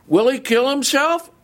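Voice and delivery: incredulous question. Acted.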